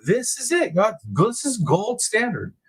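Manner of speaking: high, slow mock praise; sarcastic disbelief. Real, not acted. Mocking the hospital's reputation to chat, high and sarcastic. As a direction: drip sarcasm onto gold standard.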